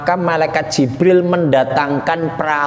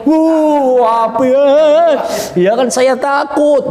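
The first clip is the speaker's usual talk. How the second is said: high, louder cry of alarm; acted fright; 7 semitones higher, 4 dB louder than usual. Person sees the fire below, play-acted frightened cry. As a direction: recoil with a drawn mock wail.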